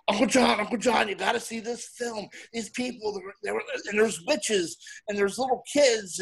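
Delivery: put-on high, eager child gushing; breathless excited nephew; excited kid voice. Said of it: "he was so excited".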